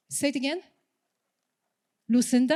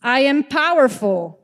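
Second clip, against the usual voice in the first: stronger, louder affirmation; 8 dB louder than usual. Shown as a demonstration. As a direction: say it like you mean it. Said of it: "One more time".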